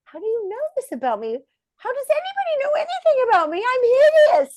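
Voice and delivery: high, fast, squeaky self-mockery; mock insecure self. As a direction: squeak out your own panic, fast. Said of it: "I was like".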